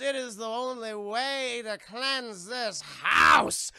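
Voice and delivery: slow mystic intoning building to a shout; Zelda the medium. Retold or imitated. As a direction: intone it slowly, swelling loud at the end.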